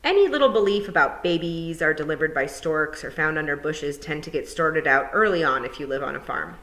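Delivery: usual speaking voice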